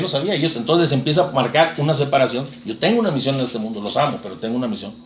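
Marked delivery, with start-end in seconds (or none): 2.6-4.9 quiet voice of Jesus. Acted. Voicing Jesus to his parents.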